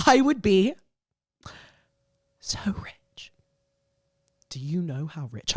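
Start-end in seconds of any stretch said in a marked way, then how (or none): 2.4-5.6 breathy whisper of "so rich". Real, not acted. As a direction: whisper it like a secret. Whispered aside.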